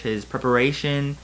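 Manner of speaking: ordinary talk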